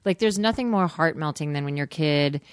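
ordinary talk

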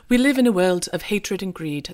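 a lightly put-on British accent, badly done; mock British voiceover, barely shifted; terrible British accent. Reading Hugh Grant's film opening in a light British accent. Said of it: "This terrible British accent".

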